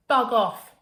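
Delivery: loud, high, cheeky bug off; cheeky retort. Acted. Girl cheeking her tidy mother, cheeky. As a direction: fling it back at mum. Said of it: "Gertrude would reply with a laugh".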